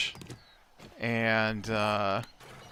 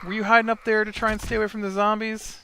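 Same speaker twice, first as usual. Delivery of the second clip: mock cooing; 10 semitones higher, faster than usual. Cooing at zombies on screen.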